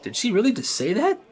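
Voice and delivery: high-pitched, incredulous question; mock a stunned student's disbelief; shocked classmates. Students reacting to their teacher's insult, shocked. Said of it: "People were shocked".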